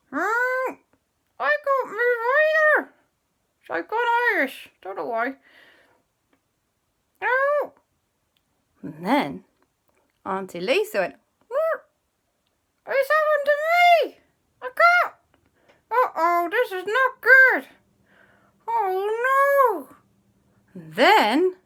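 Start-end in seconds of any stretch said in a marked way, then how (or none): 0.1-7.8 high, strained whine of panic; frozen stiff. Acted. Second character frozen, calls for help. 11.5-19.9 very high, strained alarm; frozen in panic. Acted. Aunt finds herself frozen too.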